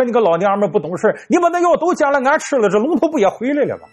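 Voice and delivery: high, fast scolding; old man scolding. Acted. Old man scolding his wife after slapping her, high fast scolding. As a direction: tell her off.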